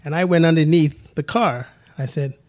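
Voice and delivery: ordinary delivery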